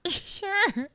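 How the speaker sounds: high-pitched, giggly reply; amused agreement. Retold or imitated. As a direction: agree while holding back laughter. Retold reply to waived fee.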